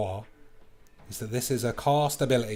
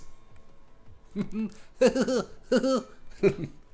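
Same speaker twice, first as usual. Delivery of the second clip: high laugh; 12 semitones higher than usual. Real, not acted.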